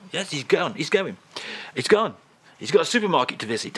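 ordinary talk

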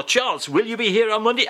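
ordinary delivery

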